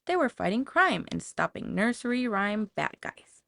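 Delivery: ordinary delivery, nothing marked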